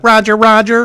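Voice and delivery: robot voice. Retold or imitated. Mocking the droids' dialogue, light robot voice. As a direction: mimic a battle droid's flat reply. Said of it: "would be like".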